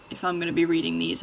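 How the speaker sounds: ordinary talk, nothing marked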